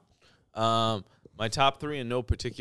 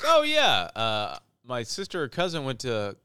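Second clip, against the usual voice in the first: much higher and louder, airy clueless voice; high mock Californian; 5 semitones higher, 8 dB louder than usual. Mocking the stranger who mixed up Saint Louis, high airy voice. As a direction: play the clueless stranger, pitched way up. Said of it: "They're like".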